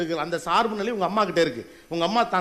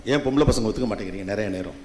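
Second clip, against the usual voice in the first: normal talking voice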